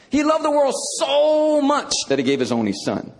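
"so" pushed high and long, as in so much; stress on so much. Shown as a demonstration. Preacher acts out the misreading, "so" stretched high. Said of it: "to mean quantitative".

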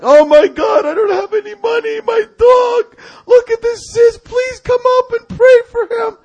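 high, loud panicked yell; mock-hysterical sister-in-law shrieking over her dog; frantic pleading. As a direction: beg for help in a hammed-up wail. Voicing frantic sister-in-law's plea.

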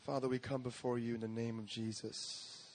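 ordinary talk, nothing marked

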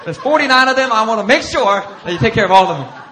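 loud, high, laughing build to the point; excited laughing preaching. Real, not acted. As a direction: get worked up and laugh through it. Excited joking about the sheep.

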